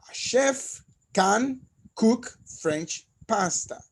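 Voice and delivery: slow, spaced out model sentence; slow example sentence. Shown as a demonstration. Teacher reading a slow example to the class. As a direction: lay out each word slowly for learners. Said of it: "For example".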